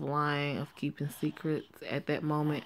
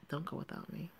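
much softer, almost under the breath; soft repeat; 13 dB quieter than usual. Acted. Friend repeating her warning to Joanne, much softer. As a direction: repeat it softly as she drifts off.

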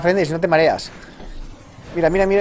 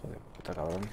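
cursing under breath; 9 semitones lower, 18 dB quieter, faster than usual. Real, not acted. Frustrated while playing alone.

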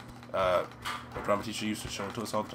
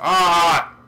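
loud, high "ah"; sudden yell cutting off the thought; startled yell; 7 semitones higher, 16 dB louder than usual. Real, not acted. Startled mid-sentence.

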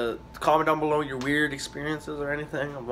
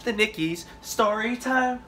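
sing-song announce; 8 semitones higher, slower than usual. Pretend. Mock announcing story time.

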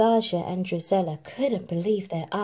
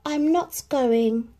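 slow, higher, resigned sigh; sighing Cinderella; 6 semitones higher, slower than usual. A narrator's reading. Cinderella tells stepmother she won't go, sighing. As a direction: let it out with a sigh. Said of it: "sighed Cinderella".

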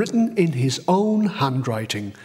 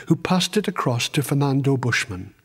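usual speaking voice

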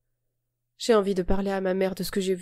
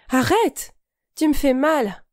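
high, louder cry of pain; 6 semitones higher, 5 dB louder, slower than usual. Acted. Girl to the boy gripping her wrist, crying out in pain. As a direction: yelp at him to stop hurting her.

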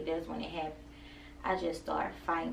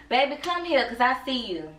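calling out; 6 semitones higher, 8 dB louder than usual. Real, not acted. Mother calling her little son over, loud and high. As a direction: call him over, loud and bright.

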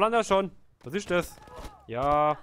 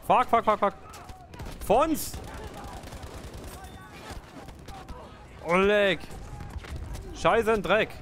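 panicked swearing; 8 semitones higher, slower than usual. Real, not acted. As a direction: shout in panic under fire. Under tank fire, swearing.

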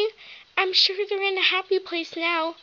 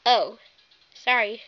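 ordinary talk, nothing marked

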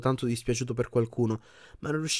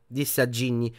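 plain reading voice

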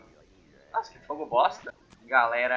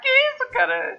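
amazed laugh; 9 dB louder, slower than usual. Real, not acted. Head flies off in game.

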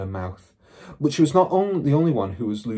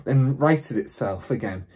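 plain reading voice

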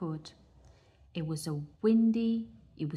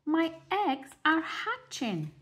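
Mother Duck; 10 semitones higher than usual. Acted. Mother Duck sees eggs hatch.

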